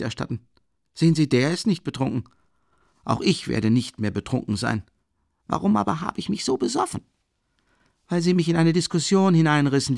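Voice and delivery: lightly voiced drunk young man; tipsy Rasumichin. A narrator's reading. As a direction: talk on, a little tipsy and earnest. Rasumichin continues monologue.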